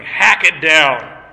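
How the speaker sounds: slow and loud, every word driven; emphatic command. Shown as a demonstration. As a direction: hit HACK hard and slow. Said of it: "Very emphatic, very intense".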